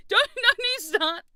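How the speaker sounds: high, slow warning breaking into laughter; can't keep a straight face while warning; laughing warning. Real, not acted. Streamer laughing while warning chat not to start.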